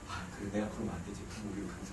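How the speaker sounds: very low, soft and restrained; suppressed temper, acted out; holding anger in. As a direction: swallow the anger and speak low. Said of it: "진짜 꾹 참고".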